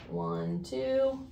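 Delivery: ordinary delivery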